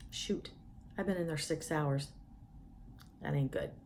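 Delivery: low, hushed, to oneself; subdued worried thought. Acted. Patient privately realizing how long surgery took, hushed and low. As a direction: think it aloud, soft and low.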